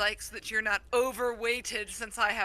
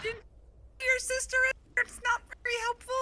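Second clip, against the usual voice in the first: higher excited yell; 13 semitones higher than usual. Real, not acted. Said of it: "Stop yelling".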